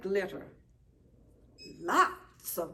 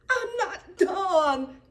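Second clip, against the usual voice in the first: high, loud, slow wail near tears; upset cry; 12 semitones higher, 11 dB louder, much slower than usual. A narrator's reading. Amy wailing at the teacher, crying out. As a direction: cry it out, close to sobbing. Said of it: "cries Amy".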